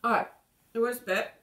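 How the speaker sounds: speaking with paste in mouth; just after brushing teeth; mouth full of toothpaste. Real, not acted.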